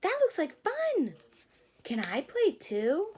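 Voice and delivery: high, eager asking to join; hopeful caterpillar. Acted. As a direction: plead sweetly to be let in. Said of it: "said Charlie".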